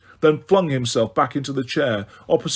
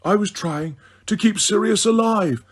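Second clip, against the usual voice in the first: plain reading voice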